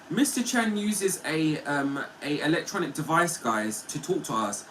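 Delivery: ordinary talk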